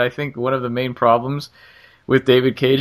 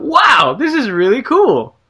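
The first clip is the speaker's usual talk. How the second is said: excited; 10 semitones higher, slower than usual. Retold or imitated. Thrilled by the game's reward.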